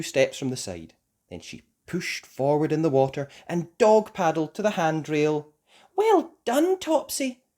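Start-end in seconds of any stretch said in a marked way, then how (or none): 5.9-7.4 put-on very high, bright praise to a child. A narrator's reading. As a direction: praise her warmly. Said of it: "said Mummy".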